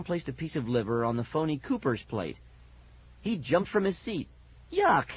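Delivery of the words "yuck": high, loud yelp of disgust; disgusted cry. A narrator's reading. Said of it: "cried out in disgust".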